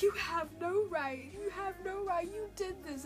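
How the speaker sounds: retelling how she yelled at the screen; mild mock yell. Viewer yelling at the villain on her iPad in mock anger. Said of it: "I was screaming at my iPad".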